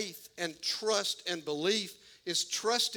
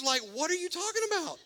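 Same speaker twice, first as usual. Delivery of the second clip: much higher, fast, squeaky disbelief; incredulous squeal; 10 semitones higher, faster than usual. Retold or imitated. Gideon protesting the jars and jelly beans, squealing in disbelief. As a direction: let the voice crack up in total disbelief.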